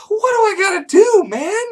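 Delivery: very high, squeaky begging; desperate plea. Retold or imitated. Man pleading with his own body, high and desperate. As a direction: plead in a high mock whine.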